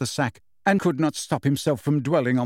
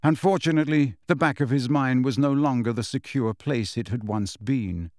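ordinary delivery, nothing marked

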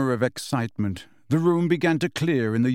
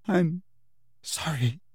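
thick with tears; 5 semitones higher, slower than usual. A narrator's reading. Cho apologizes to Harry, thick and teary. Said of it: "she said thickly".

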